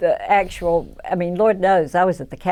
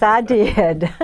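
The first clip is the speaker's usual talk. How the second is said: ordinary delivery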